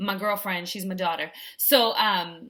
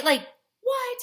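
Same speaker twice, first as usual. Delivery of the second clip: high, incredulous what; can't believe it; mocking her own fear, high; 3 dB louder than usual. Real, not acted.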